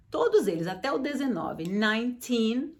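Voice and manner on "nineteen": put-on nineteen said slowly with teen stressed. Shown as a demonstration. Teacher showing students the strong final syllable, slow. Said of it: "o teen é que você vai falar forte".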